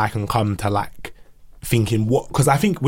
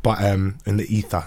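ordinary talk

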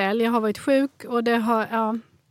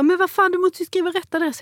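mock furious courier, pitched higher; hammed-up outraged shouting, higher; imitating an angry yell; 6 semitones higher than usual. Delivery man yelling at her about the address, mock angry. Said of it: "Han bara vrålar och skriker på mig".

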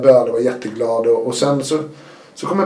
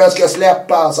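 higher and louder, exultant; retold excited cry; 5 semitones higher, 5 dB louder, slower than usual. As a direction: announce your freedom with a mock shout. Retelling wild joy at release. (uppspelt utrop)

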